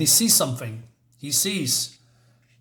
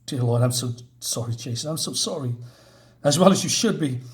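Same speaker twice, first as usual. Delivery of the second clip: usual speaking voice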